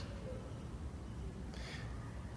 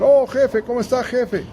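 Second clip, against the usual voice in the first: much higher, mock-deferential greeting; coworkers teasing; 9 semitones higher than usual. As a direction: play the joking coworkers hailing the boss. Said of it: "la gente se ríe y me dicen". (voz mucho más aguda de compañeros bromeando)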